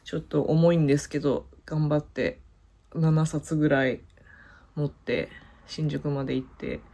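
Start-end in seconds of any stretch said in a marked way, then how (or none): none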